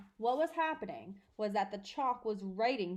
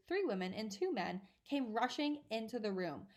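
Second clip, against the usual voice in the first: ordinary delivery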